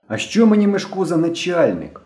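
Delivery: high-pitched, mildly defiant retort; independent air. A narrator's reading. Henka refusing to answer to Myshko, defiantly independent. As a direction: brush off the boss with independence. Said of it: "з незалежним виглядом відповів Генка".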